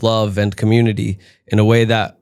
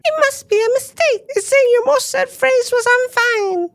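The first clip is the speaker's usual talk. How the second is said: squeaky Tinky Winky. Pretend. Tinky Winky telling God the bad news, in a tiny squeaky voice. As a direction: squeak it like a tiny Teletubby reading an iPad. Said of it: "he looks up and he goes".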